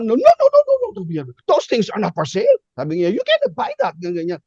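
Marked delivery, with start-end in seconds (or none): 0.0-0.9 high, loud angry refusal. Retold or imitated. Angry stewardess refusing to sell headsets, hammed-up outrage. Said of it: "Nagalit siya". 1.5-2.6 imitated angry scolding, pitched up. Stewardess telling a passenger off about headsets, angry. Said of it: "talaga nagalit siya". 3.1-4.0 very high indignant scolding; angry. Retold or imitated. Stewardess refusing a passenger's request, hammed-up anger. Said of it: "talaga nagalit siya".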